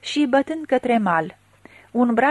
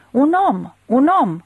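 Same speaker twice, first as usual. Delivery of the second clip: higher, urgent double cry; alarmed calling out; 4 semitones higher, 5 dB louder than usual. A narrator's reading. Villagers spot a man in the flood, alarmed calling out. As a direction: call out the sighting, louder on the repeat.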